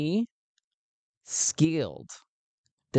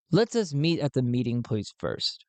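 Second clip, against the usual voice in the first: ordinary talk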